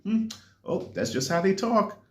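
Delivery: soft, high mock-mom voice; unbothered mother. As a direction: imitate a passive mom brushing it off, quietly. Voices the passive mother shrugging it off.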